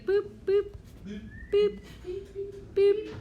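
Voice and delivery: high little boop noises; playful boops. Acted. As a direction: chirp each boop up high. Playful sound effect while marking.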